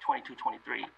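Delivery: ordinary talk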